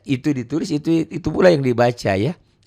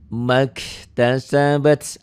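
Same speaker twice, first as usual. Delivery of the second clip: slow tajwid chant; Quran recitation; 3 semitones higher, much slower than usual. Shown as a demonstration. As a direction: draw the words out like a reciter. Recitation example. (lantunan tartil pelan)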